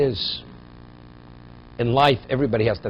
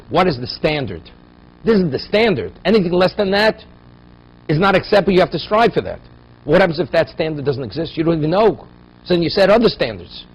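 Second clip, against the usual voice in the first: raised, emphatic sermon voice, pushing each point; forceful preaching; 5 semitones higher, 6 dB louder than usual. Acted. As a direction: preach it hard to the congregation. Rabbi exhorts the audience forcefully.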